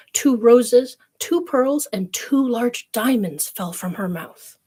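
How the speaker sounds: plain reading voice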